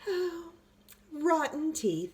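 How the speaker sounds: high, slow exasperated sigh; weary tooth fairy. A narrator's reading. Tooth fairy fed up with the teeth, high and slow. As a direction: groan it in fed-up fashion.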